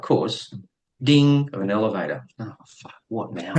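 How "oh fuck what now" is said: breathy whispered curse under the breath; muttered dread. Retold or imitated. In the evacuated building, hearing the elevator, a whispered curse. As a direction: let it slip out as a hushed dread.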